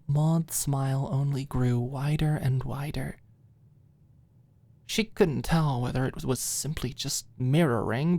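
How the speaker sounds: normal talking voice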